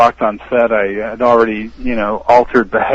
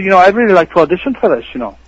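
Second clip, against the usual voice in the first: put-on quick, higher-pitched Irish accent; 7 semitones higher, faster than usual. Actor in Irish character asking to audition, Irish accent. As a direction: speed through it in an Irish accent. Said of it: "I had this Irish accent going".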